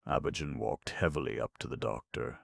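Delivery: plain reading voice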